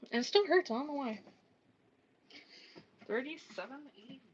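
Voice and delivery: normal talking voice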